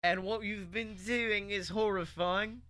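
slow British wizard voice; drawn-out, hammy British accent; bad British accent. Wizard lecturing DeVito, slow bad British accent. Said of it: "he goes".

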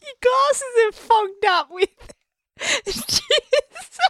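very high, tearful laughing squeak; helpless laughter. Real, not acted. Co-host teases host about fogged glasses, laughing helplessly. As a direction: squeeze words out between real laughs.

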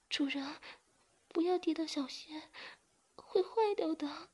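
very high, pleading, slowing at the end; begging. A narrator's reading. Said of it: "马上求饶道".

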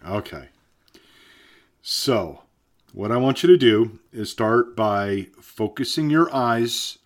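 ordinary delivery

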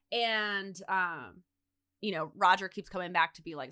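ordinary delivery

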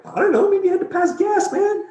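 high squeaky falsetto teasing; silly joking voice. Retold or imitated. Husband joking to his wife about passing gas, squeaky falsetto. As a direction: tease her in a goofy squeal.

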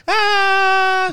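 high piercing mock scream; imitated wife scream. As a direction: shriek it like a startled wife. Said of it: "My wife is like".

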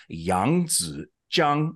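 "Yangtze Jiang" as Yahng-dzuh Jyahng, slowly; correct Chinese pronunciation. Shown as a demonstration. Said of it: "is how you say that shuttle".

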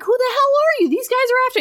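mock hero panics under gunfire; high mock yell. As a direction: squeal it in hammed-up alarm. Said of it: "he's like".

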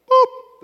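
high loud mock scanner beep. Preacher mimics checkout scanner, high loud beep.

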